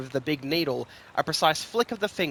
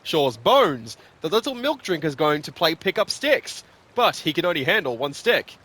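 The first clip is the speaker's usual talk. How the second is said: mocking Nord; 3 dB louder than usual. Nord mocks the Dwemer.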